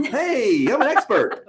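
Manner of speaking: overselling your expertise; mock eager expert.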